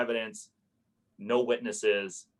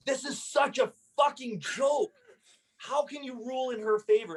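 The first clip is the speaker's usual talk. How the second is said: furious outburst. Retold or imitated. Landlord furious after slamming his hand on the table. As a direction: rage at the unfair ruling, voice way up. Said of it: "I slammed my hand on the table".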